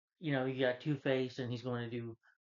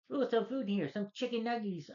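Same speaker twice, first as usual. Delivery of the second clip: very high, squeaky, excited cooing; imitating Harley spotting snacks in the car; Harley Quinn voice; 10 semitones higher than usual. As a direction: chirp it like a giddy cartoon girl. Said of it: "I just loved Tara Strong's voice".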